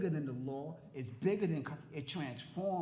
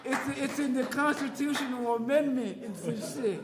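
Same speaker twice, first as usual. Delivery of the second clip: loud, higher, laughing through the words; laughing while talking; 7 semitones higher, 9 dB louder than usual. Real, not acted. Laughing at law as gangsterism.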